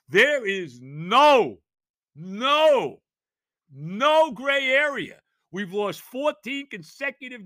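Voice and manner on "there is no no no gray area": each no drawn out, slow and loud; slow, loud insistence that there is no middle ground; slow emphatic declaration. Real, not acted. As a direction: hammer every word home, slowly. Insisting on must-win game.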